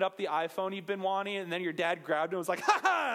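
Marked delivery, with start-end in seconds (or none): 2.6-3.1 loud, high taunting ha ha; mocking dad laugh. Dad taunting his kid over the phone, mocking laugh.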